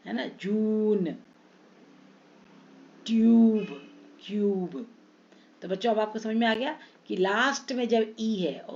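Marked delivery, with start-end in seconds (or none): 0.4-4.9 long oo, one word at a time; stretched long u. Shown as a demonstration. Teacher drilling silent-e words, long u drawn out. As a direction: hold the ऊ in "जून", "ट्यूब", "क्यूब". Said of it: "इसको लंबा खींचना है".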